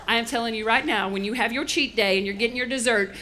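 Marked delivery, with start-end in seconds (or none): none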